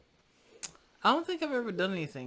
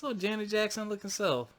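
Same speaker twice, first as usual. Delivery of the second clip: teasing laughter; 5 dB louder than usual. Real, not acted. Teasing friend's cheekbones.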